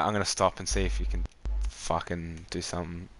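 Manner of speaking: ordinary talk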